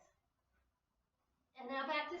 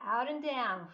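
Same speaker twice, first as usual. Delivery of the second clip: guided breathing; 8 dB louder than usual. Shown as a demonstration. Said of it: "Do it with me".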